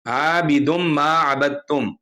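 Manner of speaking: measured recitation with held long vowels; Quran recitation. Shown as a demonstration. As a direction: recite the verse slowly and carefully. Recites a Quran verse as example.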